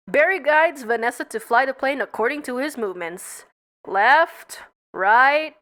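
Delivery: ordinary delivery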